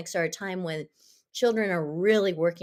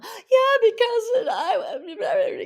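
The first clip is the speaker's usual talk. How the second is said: put-on high, loud tearful child's wail; crying child; 7 dB louder, much slower than usual. Teacher imitating a crying child at the peace table, high loud sob. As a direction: sob it out like an upset kid.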